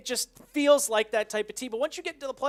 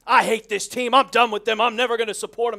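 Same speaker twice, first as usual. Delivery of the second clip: louder, hammed-up whiny quitting fan; mock fan tantrum; 7 dB louder than usual. Mocking a bandwagon fan quitting on the team, loud and whiny. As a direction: ham up a fair-weather fan giving up.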